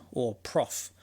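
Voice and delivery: usual speaking voice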